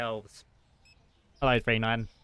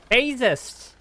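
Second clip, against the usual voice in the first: much higher, louder sudden cry; startled yelp; 12 semitones higher, 9 dB louder than usual. Real, not acted. Overwhelmed shop owner caught off guard, startled yelp. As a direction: jump at something on screen.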